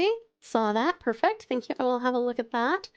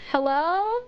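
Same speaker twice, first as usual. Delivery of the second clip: higher, rising call-out; mild questioning call; 6 semitones higher than usual. Acted. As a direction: call out tentatively, without full shouting. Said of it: "Shimi shouted".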